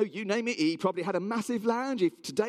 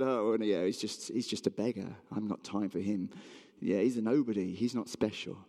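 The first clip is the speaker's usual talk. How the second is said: dismissive; 9 semitones lower, 3 dB quieter than usual. Acted. Imagined dismissive reply by Jesus, acted.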